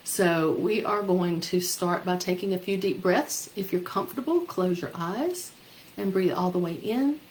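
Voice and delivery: soothing, unhurried guide through breathing; guided meditation. Acted. Leads loving kindness meditation.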